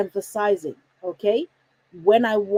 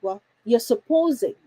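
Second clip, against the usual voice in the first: ordinary talk, nothing marked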